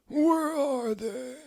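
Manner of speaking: reedy high wizard voice; high old wizard. Acted. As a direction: play a doddering headmaster, mocking. Dumbledore voiced.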